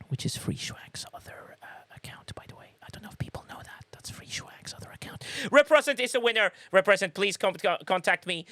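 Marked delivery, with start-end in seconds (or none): 0.1-5.2 hushed confiding whisper; whispered aside. Real, not acted. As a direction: lean in and whisper the secret. Secret aside about other account.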